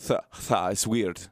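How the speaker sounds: put-on slow, deliberate comic Russian accent; mock Russian accent. Host explaining why Russians cannot say th, in mock Russian accent. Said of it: "in Russian accent".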